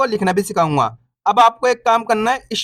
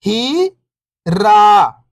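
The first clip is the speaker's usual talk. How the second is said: first syllable lifting, pause, second falling; 3 dB louder, slower than usual. Shown as a demonstration. Teacher breaking a word down for beginning readers, syllable by syllable. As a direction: sound out each syllable alone, long and separated. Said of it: "एक अक्षर का उच्चारण करके".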